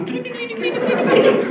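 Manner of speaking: very high, rolling sing-song patter; playful squeaky tumbling imitation; mimicked tumbling. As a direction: roll the word out like a bouncing ball. Imitating something tumbling like a ball.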